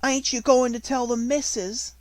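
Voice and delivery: high anxious cockney maid; frightened. Acted. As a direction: beg in fear.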